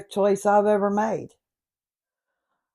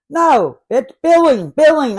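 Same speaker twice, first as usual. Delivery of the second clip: loud, higher, exasperated insistence; correcting the robot, shouting billing louder each time; frustrated shout; 7 semitones higher, 7 dB louder than usual. Acted. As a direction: yell the word at a deaf machine. Shouting at voice recognition.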